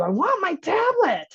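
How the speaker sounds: put-on high, slow drawn-out kid demand; screaming child. Defiant child demands tablet from parent, high and drawn out. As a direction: whine it out like a defiant toddler. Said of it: "He just screams at you".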